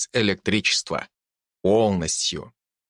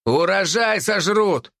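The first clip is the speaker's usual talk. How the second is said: shouting; 8 semitones higher, slower than usual. Acted. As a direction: yell the warning. Workers argue.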